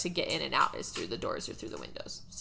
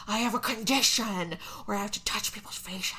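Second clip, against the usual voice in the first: whispered. Retold or imitated. Abraham explains the face touching in a creepy whisper. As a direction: breathe it like a creepy secret. Abraham's weird excuse retold.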